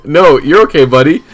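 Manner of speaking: reassuring. Retold or imitated. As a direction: soothe him like a sad kid. Comforting a crying friend on morphine.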